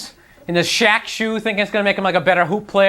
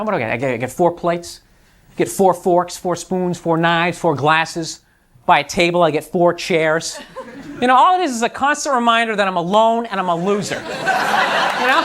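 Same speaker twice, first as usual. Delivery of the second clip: ordinary talk, nothing marked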